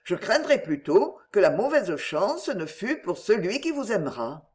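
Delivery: plain reading voice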